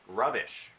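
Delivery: "Rubbish"를 영국식 억양으로 짧게 말하기; British word. Real, not acted. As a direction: say "Rubbish" in a light British accent. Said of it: "What am I, British?".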